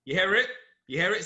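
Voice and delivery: normal talking voice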